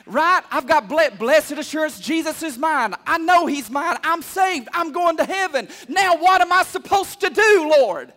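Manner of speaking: loud, high, driving declaration; full-force preaching. Real, not acted. Preacher voicing a saved believer's assurance, full-force preaching. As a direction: build to a loud cry to the Lord.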